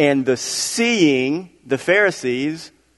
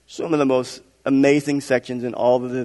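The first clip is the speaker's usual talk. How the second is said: ordinary delivery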